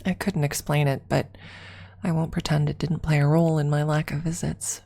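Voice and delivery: ordinary delivery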